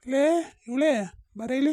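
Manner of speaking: loud call to his wife. Acted. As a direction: shout for her to come over. Husband calls wife from afar. (ಹೆಂಡತಿಯನ್ನು ಜೋರಾಗಿ ಕೂಗುವುದು)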